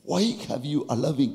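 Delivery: ordinary talk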